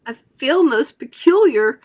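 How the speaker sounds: slow, gasped words; gasping. A narrator's reading. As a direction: gasp it out. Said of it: "gasped Violet".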